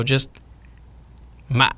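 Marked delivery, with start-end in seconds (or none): 1.5-1.7 "ma" very short, stopped in the throat; brief "ma" ending in a closed throat; cut-off dot tone. Shown as a demonstration. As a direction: cut "ma" off abruptly, no reopening. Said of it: "your throat cutting off".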